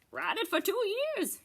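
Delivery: squeaky little goat vendor; Pygmy Goat voice. Acted. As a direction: pitch like a tiny salesman. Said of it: "he told Mister Goat".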